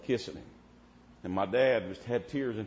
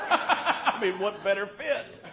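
laughing; 9 semitones higher, 5 dB louder, slower than usual. Real, not acted. Laughing at own joke.

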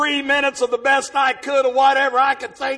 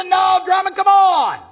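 loud coaxing call; urging shout; 7 semitones higher, 7 dB louder, slower than usual. Retold or imitated. Pastor urging a scared Drummond, loud coaxing shout. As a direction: push him forward with a shout.

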